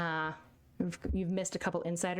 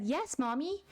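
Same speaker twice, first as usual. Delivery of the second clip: higher, lightly acted little-kid voice; obedient child; 5 semitones higher, slower than usual. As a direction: play the agreeable child answering mom. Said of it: "Baby Canada said".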